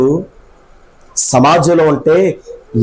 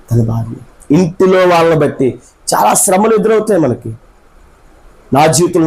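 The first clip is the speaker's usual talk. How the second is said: ordinary talk, nothing marked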